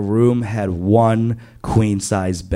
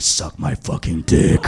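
lightly voiced low, lustful crush; a touch of low-voiced come-on; imagined seductive command; 6 semitones lower than usual. Crush imagined replying to his grab, mock seductive.

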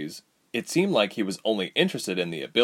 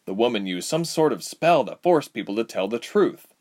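plain reading voice

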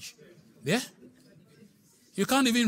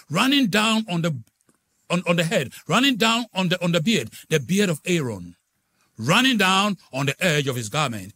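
psalm read aloud; 3 semitones higher, 7 dB louder than usual. Acted. Reading psalm aloud, loud.

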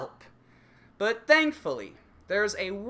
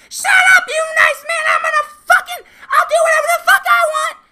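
much higher, much louder screaming with a manic laugh; furious shriek; 15 dB louder, faster than usual. Acted. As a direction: shriek him down like a raging girlfriend. Girlfriend screaming in acted quarrel.

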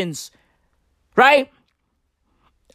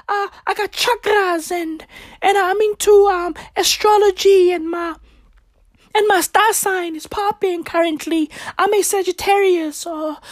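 higher airhead singer voice with cooed oohs; 5 semitones higher than usual. Retold or imitated. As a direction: imitate a would-be mystical songstress, trailing between thoughts. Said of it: "All of these girls are trying to be deep".

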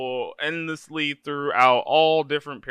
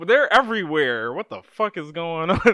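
higher-pitched exasperated confusion, played for laughs; mock bewilderment; 5 semitones higher, 5 dB louder than usual. Player swamped by Whispers that are everywhere, mock bewildered. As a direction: act out losing track, voice raised in pitch.

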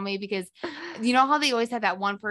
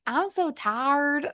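slow, higher southern drawl; imitating southern drawl; 5 semitones higher, much slower than usual. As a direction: mimic a friend's drawn-out southern twang. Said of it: "just now you were like".

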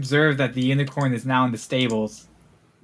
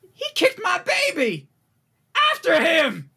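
much higher mock mother yelling; outraged mother; 13 semitones higher than usual. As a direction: shriek it out like a furious mother chasing a thief. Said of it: "the child's mother goes like".

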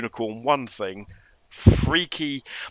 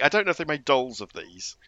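ordinary delivery

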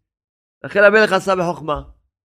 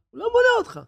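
high mocking cry; 5 dB quieter, much faster than usual. Preacher to listener, high insisting cry.

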